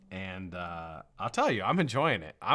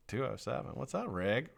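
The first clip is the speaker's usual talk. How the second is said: ordinary talk, nothing marked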